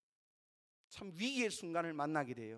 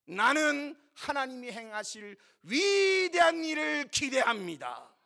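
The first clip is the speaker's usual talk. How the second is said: loud, slow, high-pitched declaration; crying out; 6 semitones higher, 10 dB louder than usual. Acted. Believer declaring expectation of God's great work, crying out. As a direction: proclaim it boldly, stretching the key words. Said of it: "아무나 외칠 수 있는 소리가 아니에요".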